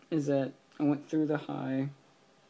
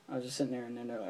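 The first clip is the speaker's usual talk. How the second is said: ordinary talk, nothing marked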